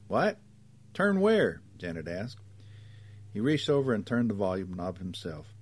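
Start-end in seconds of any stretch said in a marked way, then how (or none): 0.1-1.6 high, loud questions; confused questioning. A narrator's reading. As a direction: call out as if you can't hear. Cannot hear her passenger.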